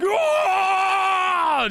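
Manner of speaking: high shouted ah from the crowd; crowd cheer. Acted. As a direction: whoop like a wild crowd. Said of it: "you see everyone go like".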